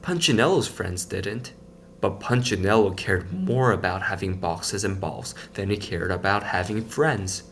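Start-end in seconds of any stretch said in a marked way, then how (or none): none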